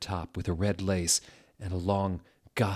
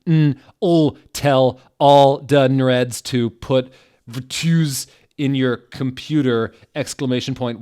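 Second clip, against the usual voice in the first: higher, louder, hammed-up angry rant; mock-furious author; 5 semitones higher, 11 dB louder than usual. The teen author yelling at her critics, in a hammed-up furious voice.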